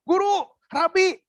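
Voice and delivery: frightened disciples crying out to Jesus in the storm; panicked cry. Retold or imitated. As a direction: cry out to the teacher in terror. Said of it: "para murid yang teriak-teriak".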